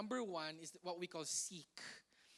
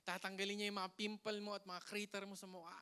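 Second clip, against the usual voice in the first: ordinary delivery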